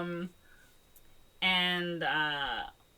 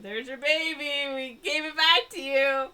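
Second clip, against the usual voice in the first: hammed-up singsong shout of surrender; mock calling out; 8 semitones higher, 5 dB louder than usual. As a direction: imitate calling to a monster, rising louder. Retold characters calling to T-Rexes.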